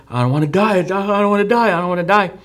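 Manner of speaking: high, pleading voice of a dying friend; desperate pleading. Retold or imitated. Dying friend begging the narrator, high pleading voice. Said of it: "And he's like".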